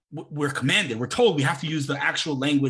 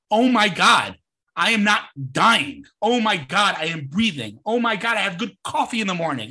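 amazed gratitude; 4 semitones higher, 4 dB louder than usual. Acted. Telling yourself what to be grateful for, amazed. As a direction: exclaim each blessing, high and emphatic.

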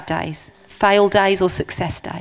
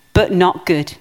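usual speaking voice

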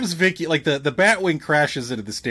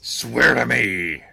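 low, slow gravelly Batman growl; low gravel vigilante growl; 5 semitones lower, slower than usual. Retold or imitated.

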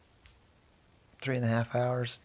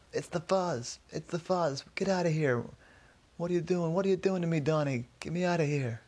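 high, urgent shout held at talking volume; mock yell; 6 semitones higher than usual. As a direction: imitate a panicked yell without real loudness. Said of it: "he heard somebody yell".

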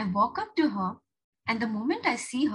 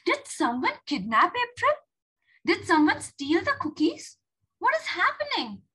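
high-pitched worried questions; alarmed questioning; 9 semitones higher, 3 dB louder than usual. Acted. Julia finding April and cookies gone, alarmed questioning. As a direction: fire off questions in growing alarm.

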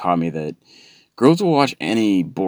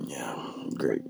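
sarcastic whisper; 6 semitones lower, 13 dB quieter than usual. Acted. Sarcastic whisper while on fire.

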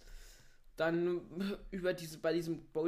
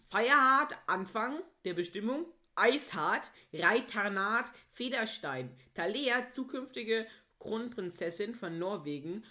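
dramatic reading; 4 semitones higher, 4 dB louder than usual. Acted. Mock dramatic reading of fanfic.

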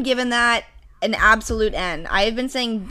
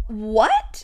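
ordinary delivery

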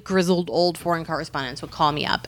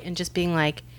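ordinary talk, nothing marked

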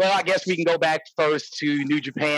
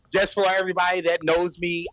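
normal talking voice

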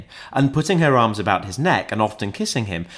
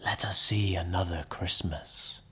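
soft, eerie whisper; ghostly whisper; 3 semitones lower, 9 dB quieter than usual. A narrator's reading. The ghost leads Scrooge onward, whispering. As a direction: whisper it like a spirit. Ghost speaks thoughtfully, waving its hand.

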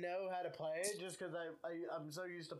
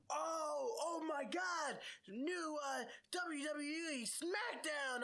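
much higher, slow, gushing promo voice; mock hype announcer; 11 semitones higher, much slower than usual. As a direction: parody the overexcited ad for the new show. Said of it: "and they're like".

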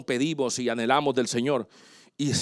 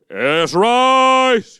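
loud, drawn-out order to the whole room; courtroom officer's call; 6 semitones higher, 6 dB louder than usual. Retold or imitated. Pastor imitating the officer when the judge enters, loud call. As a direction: call it out high and long like a bailiff.

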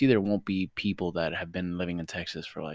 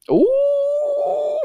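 long, high, loud "ooh"; teasing "ooh"; 9 dB louder than usual. Real, not acted. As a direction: let out a high drawn-out ooh. Playful reaction to a guess.

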